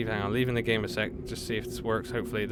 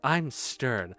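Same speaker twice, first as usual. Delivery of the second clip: mock-stern, slightly raised voice; 5 dB louder, slower than usual. Streamer trying out a voice for the mom, mock-stern. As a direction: play a strong independent woman, half-joking. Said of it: "it's just me sounding angry".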